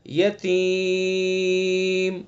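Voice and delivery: یتیم with the vowel held very long for five counts; five-alif elongation. Shown as a demonstration. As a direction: stretch یتیم to the full five beats. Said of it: "پانچ الف ہو گئے".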